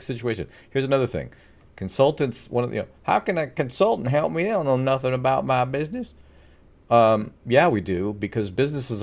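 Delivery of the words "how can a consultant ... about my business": doubtful prospect, pitched up; skeptical client voice. Retold or imitated. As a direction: play the unconvinced business owner.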